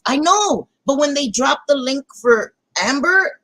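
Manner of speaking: ordinary talk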